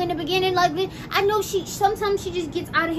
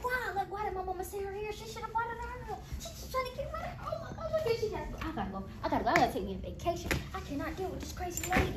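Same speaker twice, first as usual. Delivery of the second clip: much softer exasperated aside; fed-up muttering; 2 semitones higher, 12 dB quieter than usual. Acted. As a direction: grumble to yourself, fed up.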